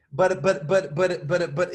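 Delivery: imitating a flustered, repeated stammer; surprised stammer. Surprised kid handed money for his candies, imitated stammering. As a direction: stumble over the same word, as if taken aback. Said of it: "like, so surprised".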